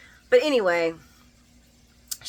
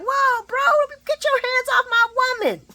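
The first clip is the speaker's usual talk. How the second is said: much higher hammed-up tough guy; macho Dex; 4 dB louder than usual. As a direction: play the jealous boyfriend, pitched way up. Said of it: "He's like".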